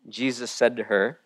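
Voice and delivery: ordinary delivery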